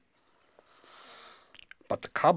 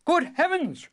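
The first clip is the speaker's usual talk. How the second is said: high, louder exclamation of alarm; startled cry; 9 dB louder than usual. A narrator's reading. King glances at the rosebush queen, crying out. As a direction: cry out in high-pitched shock. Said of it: "cried the king".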